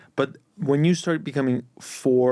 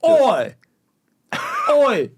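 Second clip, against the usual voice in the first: high, loud Jamaican-Londoner hailing someone; punchy, high-pitched mock Jamaican accent; 11 semitones higher, 4 dB louder than usual. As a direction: call out in hammed-up Jamaican London patois. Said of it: "Jamaican, Jamaican, uh, li-, like, the Jamaican part of London".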